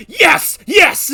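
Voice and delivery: high, loud triumphant yes; excited cheer. Real, not acted. Excited cheering at game event.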